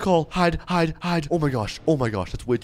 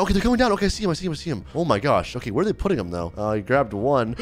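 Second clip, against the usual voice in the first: usual speaking voice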